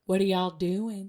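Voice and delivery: light, calm Southern imitation; mild calm Southern accent. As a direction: ask it gently with a hint of a Southern accent. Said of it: "In just the most calm, wonderful voice".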